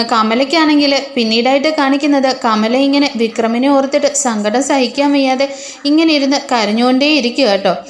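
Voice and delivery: ordinary delivery, nothing marked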